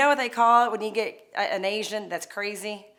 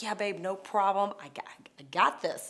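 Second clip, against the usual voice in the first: whisper. Retold or imitated. Husband's drunk reply retold.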